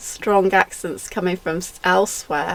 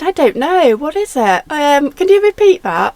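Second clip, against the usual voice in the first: baffled; 8 semitones higher, 5 dB louder than usual. Retold or imitated. Retold baffled reply on phone.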